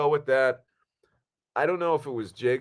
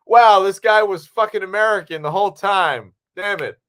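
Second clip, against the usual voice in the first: high, loud mock outburst; astonished viewer; 9 semitones higher, 8 dB louder than usual. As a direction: exclaim as an astounded moviegoer. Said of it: "at the end it's like".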